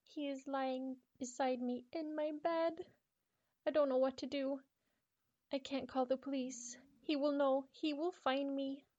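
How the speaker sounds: afraid he will hear her; terrified girl. Acted.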